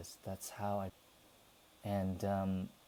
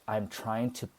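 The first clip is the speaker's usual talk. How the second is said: usual speaking voice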